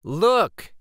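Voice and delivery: ordinary delivery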